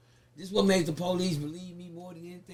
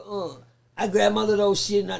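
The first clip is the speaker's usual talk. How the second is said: normal talking voice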